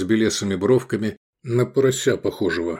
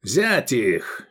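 barked order; 2 semitones higher than usual. A narrator's reading. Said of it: "и рявкнул".

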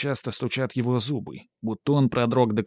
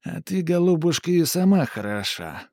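creaky witch voice; 6 semitones higher than usual. Acted. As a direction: creak like a dry old tree. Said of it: "с голосом скрипучим, как старое сухое дерево". (скрипучий голос ведьмы)

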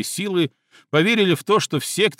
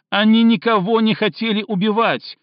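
high, loud defensive cry; shouting; 8 semitones higher, 5 dB louder than usual. Acted. Cindy defending her friends to Stinov, shouting. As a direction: yell in their defence. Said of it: "снова закричала Синди".

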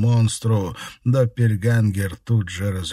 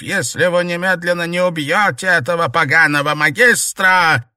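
high, loud raging commands; furious shouting; 8 semitones higher, 7 dB louder than usual. A narrator's reading. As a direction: scream threats like a mad tyrant. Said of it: "злобно рявкнул старик".

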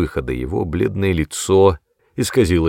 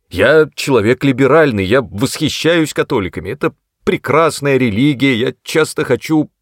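higher, louder flustered insisting; eager protesting; 6 semitones higher, 5 dB louder than usual. A narrator's reading. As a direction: rush to reassure him. (взволнованно оправдываясь)

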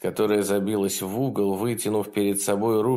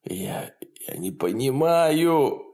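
sobbing; 5 semitones higher, slower than usual. A narrator's reading. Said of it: "всхлипнул он".

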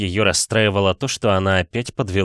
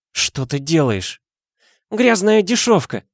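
angry cry; 10 semitones higher, 3 dB louder, slower than usual. A narrator's reading. Angry tearful Dasha.

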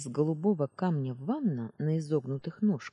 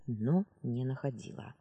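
ordinary delivery, nothing marked

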